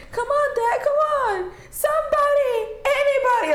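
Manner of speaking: very high, mock-desperate cub voice; pleading child character. Acting out the cub at Mufasa's death, high desperate pleading. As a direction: plead for help in a high little voice. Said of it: "every time when Mufasa died".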